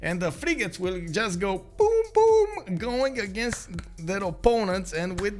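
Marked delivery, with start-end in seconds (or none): 1.8-2.8 yelled high boom sound effect; cannon boom. Acted. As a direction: shout boom like a cannon blast. Frigates firing.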